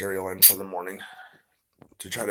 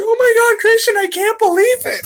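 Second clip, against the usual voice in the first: imitating the clerk, much higher and louder, near tears; tearful overjoyed clerk; 16 dB louder than usual. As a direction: squeal it like a sobbing happy clerk. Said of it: "She's like".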